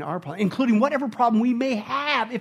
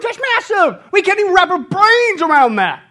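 ordinary talk

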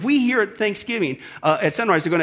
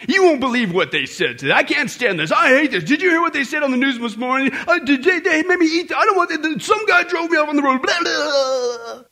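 whiny complainer; 7 semitones higher than usual. Retold or imitated. Mocking complainers' whining.

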